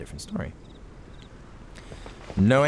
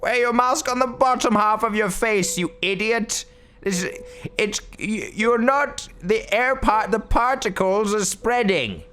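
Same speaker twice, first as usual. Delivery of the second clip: hammed-up lecture; mock scolding; 10 semitones higher than usual. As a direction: scold the masked NPC, slowing at the end. Scolding game character.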